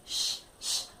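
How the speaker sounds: unvoiced shh hiss; just the sh sound, whispered. Shown as a demonstration. Teacher isolating the sh sound. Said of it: "Esse sonzinho é o sonzinho do C H".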